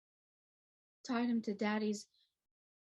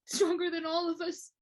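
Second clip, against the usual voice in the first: strained, higher pitch, rising toward an outburst; grieving; 7 semitones higher, 4 dB louder than usual. Acted. Speaker remembering how strong the lost girl was, grieving. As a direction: voice climbing high in mounting grief.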